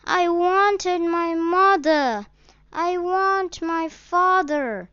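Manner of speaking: put-on lost boy weeping to a stranger; weeping child. A narrator's reading. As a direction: voice of a child in tears. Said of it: "The child sobbed more bitterly".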